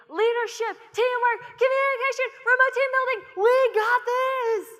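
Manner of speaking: very high, full-volume team cheer; yelling. Acted. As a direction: yell buzzwords like an overeager young player.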